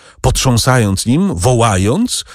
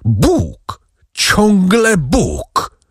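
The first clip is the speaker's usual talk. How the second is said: heart-rending cry; 10 semitones higher, much slower than usual. A narrator's reading. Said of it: "rozdzierający krzyk".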